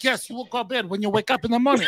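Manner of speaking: high-pitched imitation Chinese accent. [name] replying to the Chinese visitor at the booth, Chinese accent. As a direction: answer in a put-on Chinese accent. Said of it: "talk to him in a Chinese accent".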